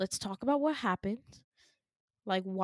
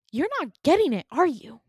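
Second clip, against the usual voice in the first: loud, high-pitched exasperation; acted frustration; 6 semitones higher, 8 dB louder than usual. Jessica on the ledge, not understood, frustrated. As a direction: throw it at them, exasperated.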